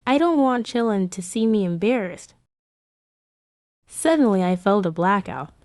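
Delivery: ordinary talk, nothing marked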